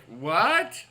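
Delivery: high, slow, baffled put-on voice; mock-bewildered high voice; imitating a puzzled viewer. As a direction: play a confused fan squeaking questions. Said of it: "some of you are wondering, like".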